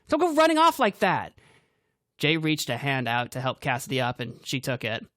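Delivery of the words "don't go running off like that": high, loud scold; angry scolding. A narrator's reading. Cassidy scolding Grant, high and loud. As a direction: scold him for running off.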